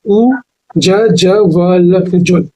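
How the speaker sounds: very slow, each part ज, ज, वल held apart; slow spelling out. Shown as a demonstration. Teacher repeating the spelling of Ujjwal, very slow. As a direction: spell out ज, ज, वल letter by letter. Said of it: "फिर से देखिए".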